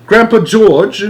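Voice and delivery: put-on high, loud boy's call; cried. A narrator's reading. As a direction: call out to him. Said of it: "cried Charlie".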